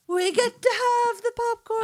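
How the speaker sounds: high, excited squeal. Retold or imitated. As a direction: squeal it like a thrilled kid. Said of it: "you were like".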